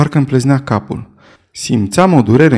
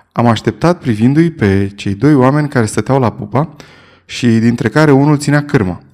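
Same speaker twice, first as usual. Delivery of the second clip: ordinary delivery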